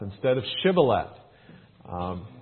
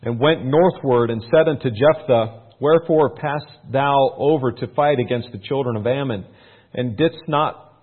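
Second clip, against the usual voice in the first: raised, louder Bible-reading voice; scripture reading; 4 semitones higher, 9 dB louder than usual. Acted. Pastor reading the Bible to his church, raised and declaiming. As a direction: read it out like a preacher to the congregation.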